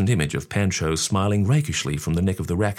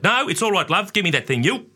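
father; 12 semitones higher than usual. A narrator's reading. Father voiced in story.

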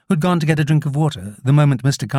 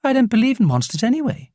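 put-on higher-pitched, mildly dismissive girl; feigned indifference; 9 semitones higher than usual. A narrator's reading. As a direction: act as if you don't care. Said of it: "as if she didn't care".

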